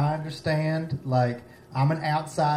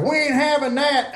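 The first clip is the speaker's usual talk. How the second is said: high-pitched townsfolk protest; mock indignation; 10 semitones higher than usual. Acting out townspeople objecting to the name, mock indignant. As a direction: pitch it up, refusing on the town's behalf.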